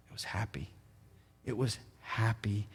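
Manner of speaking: hushed whispered answer. Real, not acted. Pastor answering his own question to the church, whispered. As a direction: whisper it like a secret. Pastor drives home the lesson.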